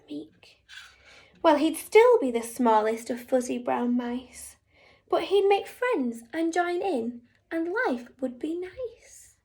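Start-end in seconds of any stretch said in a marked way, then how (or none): none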